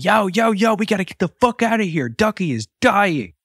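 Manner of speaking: high-pitched mock panic; freaking out. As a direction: raise the alarm in a high, urgent voice, stretching the last word. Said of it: "starts freaking the fuck out".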